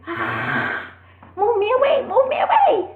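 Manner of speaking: put-on very high, loud child's protest; protesting baby. Baby pushing away from the parent holding it, high loud protest. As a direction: play a furious baby squirming to get free.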